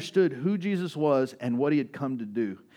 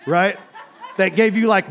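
laughing; 4 semitones higher, 9 dB louder than usual. Real, not acted. Laughs at own joke.

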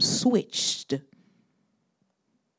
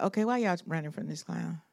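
muttered scorn; 9 dB quieter, faster than usual. Retold or imitated. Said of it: "David is standing there like".

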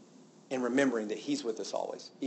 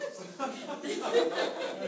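incredulous laugh; 13 semitones higher than usual. Real, not acted. Laughs at quoted claim.

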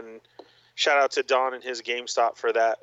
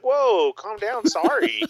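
much higher, louder backing-off whoa; mock startled; 11 semitones higher, 4 dB louder, slower than usual. Calming the mad Walgreens clerk, mock startled and higher. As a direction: pretend to flinch from an angry clerk.

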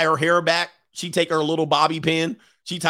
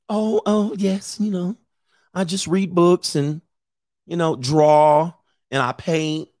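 put-on nerd girl. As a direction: play an awkward girl fumbling through small talk. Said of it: "She a nerd girl".

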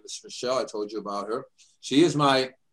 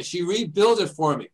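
ordinary talk, nothing marked

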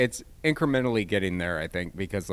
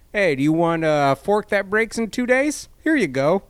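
mock cheery seller; 9 semitones higher, 8 dB louder than usual. Mock voicing Amazon seller.

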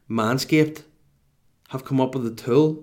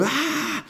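exasperated scream. Retold or imitated. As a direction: let out a high, strangled "ah" at breaking point. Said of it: "a second away from going".

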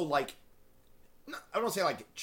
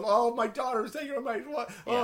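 mock rant; 7 semitones higher than usual.

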